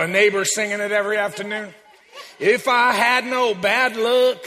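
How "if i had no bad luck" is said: slow, sung southern country drawl; hammed-up southern drawl, sung slow. Preacher sings a bad-luck line in a southern drawl. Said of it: "I sing this with a southern drawl".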